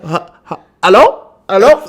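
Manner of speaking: put-on Korean accent. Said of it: "he switched to a Korean accent".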